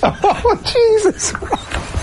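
high, drawn-out laughing "Jesus Christ"; laughing exclamation. Real, not acted. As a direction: burst out laughing in disbelief. Shocked by christening joke.

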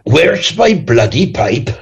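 drawn-out, high-pitched attempt at a Cork accent; slow mock-Irish voice of a grandfather; grandfather's Cork accent. Guest imitating his grandfather from Youghal, Cork accent. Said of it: "he'd go".

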